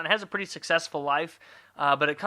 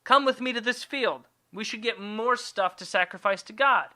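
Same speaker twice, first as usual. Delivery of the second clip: higher, falsely casual invitation; coaxing Cain; 6 semitones higher than usual. Acted. Cain inviting Abel to the field, coaxing. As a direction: lure him along in a raised voice.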